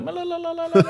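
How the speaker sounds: "Ma la la la la la la" as a very high babble; mock pronunciation. Host teasing guest's surname, "Ma la la la la la la" babbled high. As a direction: rattle "Ma la la la la la la" way up high. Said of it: "That's how you say it".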